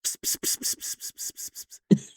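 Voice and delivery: whispered psst psst of a spray bottle; spray bottle noise. Retold or imitated. Skit guy spritzing humidity on someone, whispered spray sounds. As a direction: hiss like a mister spraying water. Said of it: "a spray bottle and is like".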